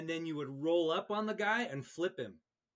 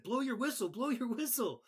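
urgent command; 9 semitones higher than usual. Retold or imitated. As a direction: push the new referee to act now. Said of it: "I said".

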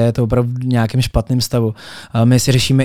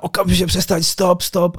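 higher-pitched retold order to stop; urgent technician; 6 semitones higher than usual. Technician to DJ mid-set, urgent order to stop. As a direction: imitate a technician urgently halting the music.

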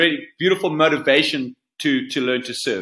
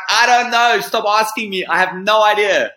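exasperated reply; 5 semitones higher, 4 dB louder than usual. Retold or imitated. Badgered about his life plans.

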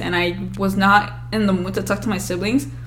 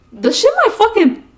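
normal talking voice

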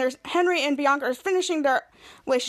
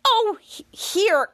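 high, exasperated "oh", then give in; annoyed giving in; 7 semitones higher, much slower than usual. Retold or imitated. Bianca cuts to the chase, annoyed giving in. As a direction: hand it over with annoyance. Bianca gives in, getting mad.

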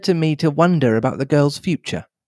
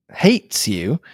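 ordinary delivery

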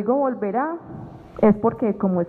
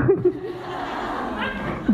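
high airy burst of laughter; laughing at her own reply; breathless laugh; 9 semitones higher, 4 dB quieter than usual. Real, not acted. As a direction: let out a real breathy giggle. Laughs after her answer.

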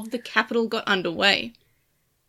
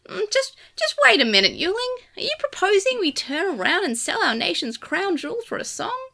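higher, startled yelp; 8 semitones higher than usual. A narrator's reading. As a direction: yelp out from behind the racks. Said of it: "yelped a voice".

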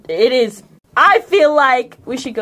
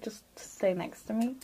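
quiet; 18 dB quieter than usual. Retold or imitated. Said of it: "be like".

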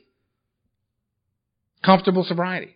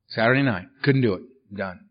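ordinary talk, nothing marked

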